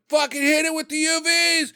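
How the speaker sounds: high-pitched mock shout of an order; retold frantic yell. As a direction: imitate someone yelling out in alarm. Retold panicked crowd yelling orders.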